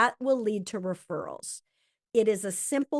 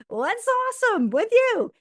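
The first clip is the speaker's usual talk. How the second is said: much higher and louder, chirpy asking; mock-bright friend asking what's awesome; upbeat question; 12 semitones higher, 7 dB louder than usual. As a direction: sing out a cheery how-are-you. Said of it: "Even when you ask 'em".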